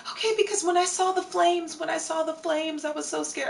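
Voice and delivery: high-pitched, rattled bystander; scared woman passerby. Retold or imitated. Bystander telling the passengers about the flames, scared high voice. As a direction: imitate her gushing out her fright in a high voice.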